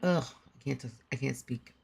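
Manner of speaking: normal talking voice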